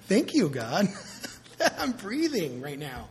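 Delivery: thanks broken by real laughter; a preacher laughs with joy while thanking God; laughing thanks. Real, not acted. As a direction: laugh through the gratitude. Laughing gratitude mid-sermon.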